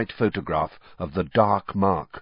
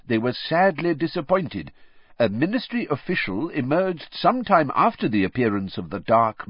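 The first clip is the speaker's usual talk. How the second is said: reading newspaper aloud; 5 semitones higher than usual. Acted. Reads article aloud.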